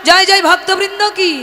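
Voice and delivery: high, loud call for the crowd to answer; loud call and response lead; rousing call. Real, not acted. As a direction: cry it out and wait for jay.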